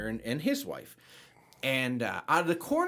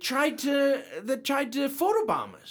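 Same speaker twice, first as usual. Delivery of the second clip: excited realization; 12 semitones higher, 5 dB louder, slower than usual. Retold or imitated. Sudden realization at the door.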